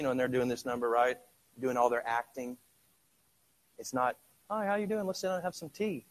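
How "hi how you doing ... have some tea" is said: mock polite. Polite contrast to wrestlers.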